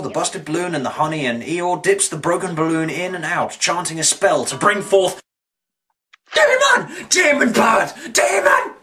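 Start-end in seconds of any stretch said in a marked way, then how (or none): 6.3-8.7 high mock-terrified shouts; panicked shrieking. Reviewer spotting a demon bird in the cartoon, mock-panicked shrieking.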